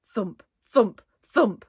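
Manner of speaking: banging sound. A narrator's reading. Banging on the wall.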